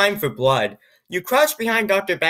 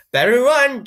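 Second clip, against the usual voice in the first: high, raised mock warning, no laugh; chuckling threat; 7 semitones higher, 5 dB louder than usual. A narrator's reading. Evil doctor warns the cousins, mock threat. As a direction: push it up and out as a villain's threat. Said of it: "unchuckles Doctor Backpack".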